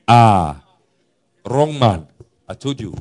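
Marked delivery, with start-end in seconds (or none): none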